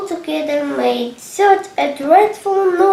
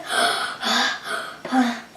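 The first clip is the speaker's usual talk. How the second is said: gasping for breath; 4 semitones lower, 4 dB quieter than usual. A narrator's reading. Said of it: "all out of breath".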